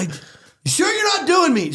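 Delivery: high, doubting challenge; squeaky impression of a suspicious star; Joe Pesci. Star still suspecting the fan's mockery, high-pitched impression. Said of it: "He thought he was making fun of him".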